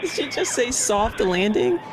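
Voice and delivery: amused, stunned double-check; incredulous. Real, not acted. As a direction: question it slowly, pitch climbing at the end. Incredulous at commentator's soft landing.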